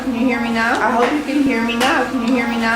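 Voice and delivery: ordinary talk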